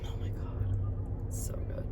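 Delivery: breathy whispered delight over food; hushed "oh my God" of pure bliss; awed whisper. Real, not acted. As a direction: whisper it like it's too good to say aloud. Tasting a bagel, awed.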